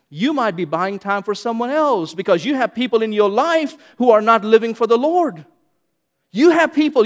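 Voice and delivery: fervent preaching. Acted. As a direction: press the warning home from the pulpit. Preaching builds to full force.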